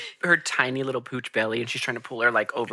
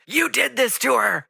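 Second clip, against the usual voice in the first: mock angry Jacob; 11 semitones higher, 3 dB louder than usual. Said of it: "he's like".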